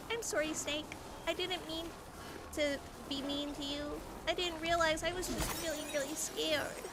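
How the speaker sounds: Wendy says sorry to the snake, meek; sheepish apology. Retold or imitated. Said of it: "she's like".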